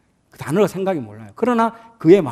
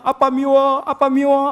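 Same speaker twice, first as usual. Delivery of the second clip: put-on crying child; 9 semitones higher, 5 dB louder, slower than usual.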